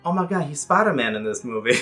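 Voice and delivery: ordinary delivery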